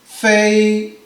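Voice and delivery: 高平调的"飞"; first-tone fei. Shown as a demonstration. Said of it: "let's say".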